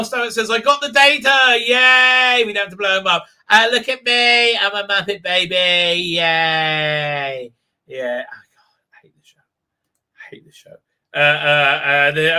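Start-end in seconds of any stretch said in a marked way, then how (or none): 0.5-7.5 high loud cheer tailing off; mock jubilation. Mocking joyful rescue at last moment. 7.9-10.8 barely audible, weary aside; exasperated whisper. Real, not acted. Aside of disgust at the show.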